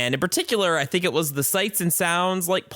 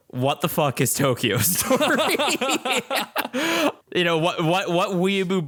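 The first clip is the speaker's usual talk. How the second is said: laughing mock listener; 4 semitones higher than usual. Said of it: "I know what you're thinking".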